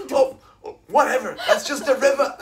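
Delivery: high, loud, laughing mock denial. Friend mocking the oblivious swimmer, high and laughing. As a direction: play the oblivious swimmer brushing it off. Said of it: "You're like".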